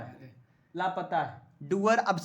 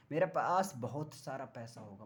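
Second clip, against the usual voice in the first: much lower and softer, almost an aside; low-key boast; 8 semitones lower, 8 dB quieter than usual. Shown as a demonstration. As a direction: confide it quietly, pitch dropped. Joking boast, then repeated aloud.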